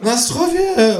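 high kangaroo toast; toast call. A narrator's reading. Kangaroo calls out a vodka toast. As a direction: raise the glass and call it out. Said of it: "ruft das Känguru".

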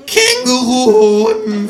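very high, loud, slow kangaroo jolt; startled outburst. A narrator's reading. Kangaroo snaps out of its trance at the word, high and loud. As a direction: jerk out of a trance, shrill. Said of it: "fährt das Känguru aus seiner Trance empor".

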